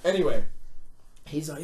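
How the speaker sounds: ordinary delivery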